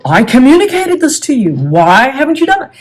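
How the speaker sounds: accusing, pitch lifted high; indignant accusation. Retold or imitated. Person who feels justified confronting their partner, indignant accusation. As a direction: demand an explanation, mildly indignant. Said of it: "And they feel".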